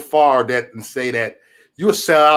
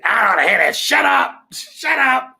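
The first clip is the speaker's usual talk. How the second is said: mock screaming, louder and much higher; retold scream; 7 semitones higher, 5 dB louder, slower than usual. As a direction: scream the uncle's shut up like he's losing it. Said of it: "that scream".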